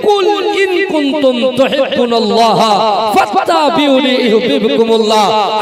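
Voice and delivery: high, loud, ringing sermon cry; full-force preaching. Acted. Preacher rousing the young men of the village, full-force sermon shout. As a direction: thunder it out to the whole hall.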